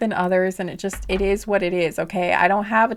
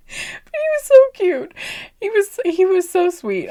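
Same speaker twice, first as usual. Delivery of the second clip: high, slow, swooning over how cute he was; gushing; 12 semitones higher, 7 dB louder than usual. Real, not acted. Remembering a sweet boy, gushing high and slow. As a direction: melt into a squeal of fondness.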